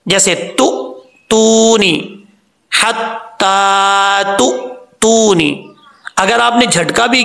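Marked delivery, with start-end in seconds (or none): 1.3-5.5 तु and तूनी with a crisp glottal jolt; हत्ता तु, तूनी with a clean hamza stop; recitation with a jerk. Shown as a demonstration. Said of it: "झटके के साथ, तो फिर तो ठीक है".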